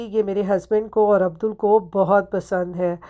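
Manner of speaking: ordinary delivery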